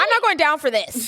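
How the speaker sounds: high, defiant refusal; defiant girl. Retold or imitated. Julie refusing to take the blame, high and defiant. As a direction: refuse flat out, high and sharp. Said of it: "And Julie".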